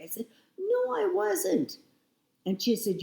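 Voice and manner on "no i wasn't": indignant denial. Retold or imitated. Denying friend's claim she was angry.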